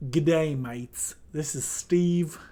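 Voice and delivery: slow, hammed-up Australian accent that barely lands; a clumsy go at an Aussie greeting, slow and drawn out; bad Australian accent. Introducing Steve the Krookodile to viewers, in a bad Australian accent. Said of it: "I can't do an Australian accent".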